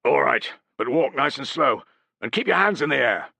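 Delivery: mild growl. A narrator's reading. Sentry grudgingly letting the pair approach. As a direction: warn them gruffly. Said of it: "the sentry growled".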